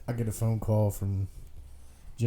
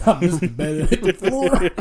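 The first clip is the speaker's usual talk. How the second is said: loud, high, laughing words; laughing through it; 9 semitones higher, 11 dB louder than usual. Retold or imitated. Retelling the brother's fall, laughing hard. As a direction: crack up while quoting the brother. Brother's quote while cracking up.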